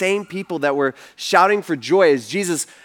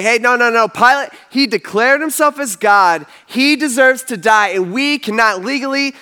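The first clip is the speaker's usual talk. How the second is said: mildly urgent, raised protest; higher, emphatic objecting; insistent objection; 6 semitones higher, 4 dB louder than usual. Retold or imitated. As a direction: argue back firmly, pitched up. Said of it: "But the religious leaders, they say".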